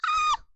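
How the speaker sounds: very high, loud embarrassed shriek; real flustered scream. Real, not acted. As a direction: let out a sharp squeal. Overwhelmed by the message.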